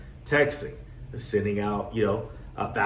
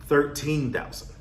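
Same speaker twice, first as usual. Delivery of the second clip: ordinary delivery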